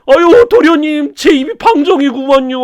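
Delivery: put-on dead servant confessing to young master; wailing ghost servant. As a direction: plead for forgiveness.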